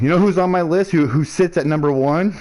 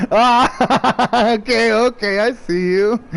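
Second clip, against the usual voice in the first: high, laughing okay; laughing acknowledgement; 5 semitones higher, much slower than usual. Retold or imitated. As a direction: concede it while laughing. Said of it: "both players like".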